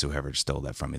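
ordinary talk, nothing marked

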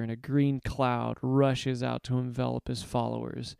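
usual speaking voice

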